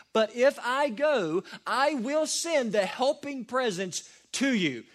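proclaiming the reworded verse to the congregation; emphatic scripture reading. Shown as a demonstration. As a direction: preach the reworded verse firmly. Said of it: "you can reread verse seven like this".

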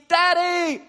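very high, loud anguished cry; crying out at full force; desperate scream. Retold or imitated. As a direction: wail for daddy. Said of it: "Your first inclination ... should be".

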